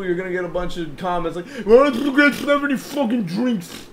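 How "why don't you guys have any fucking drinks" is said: high, loud customer griping; mock complaint. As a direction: imitate an outraged customer complaining, pitched up.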